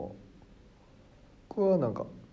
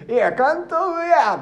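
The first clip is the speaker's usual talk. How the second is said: pretend retort; 12 semitones higher, 3 dB louder, slower than usual. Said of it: "っていうトークもある".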